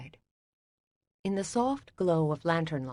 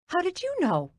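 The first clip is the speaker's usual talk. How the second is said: astonished question; 13 semitones higher than usual. Acted. Startled that he guessed.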